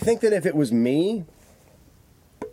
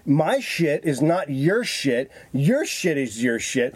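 slow and deliberate, each word spaced out; slow, firm retelling; much slower than usual. Retold or imitated. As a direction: lay it out slowly like talking to a child. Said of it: "I got in dude's face and I said".

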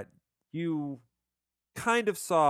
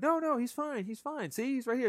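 higher, hammed-up soothing patter; magician reassuring; 11 semitones higher than usual. Bale's magician to the crying boy, mock high soothing. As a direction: play the showman calming a crying kid.

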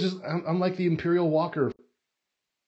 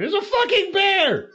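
startled; 7 dB louder, slower than usual. Retold or imitated. Bear surprise on ride.

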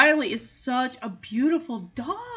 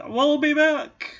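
villain threat; 4 semitones higher, 4 dB louder than usual. Retold or imitated. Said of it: "they threaten with".

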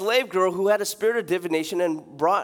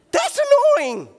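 squeaky high exasperated cry; high comic whine; 8 dB louder than usual. Real, not acted. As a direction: shriek it in mock exasperation. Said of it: "exaggerated exclamation".

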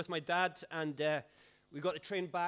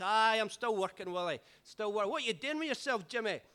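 higher, louder mock voice of dad; dad answering the drunk pal, lightly hammed; dad's voice; 6 semitones higher, 7 dB louder than usual. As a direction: play dad humouring the drunk. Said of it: "He's like".

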